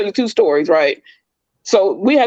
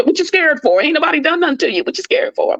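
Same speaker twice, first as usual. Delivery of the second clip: mocking taunt; 9 semitones higher than usual. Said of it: "I'm like".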